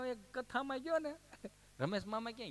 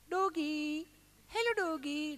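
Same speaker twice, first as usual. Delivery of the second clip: higher, sweet mock baby-talk to a dog; cooing at a dog; 8 semitones higher, slower than usual. City visitor holds up a phone and coos at the dog. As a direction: coax the puppies like a city pet-lover.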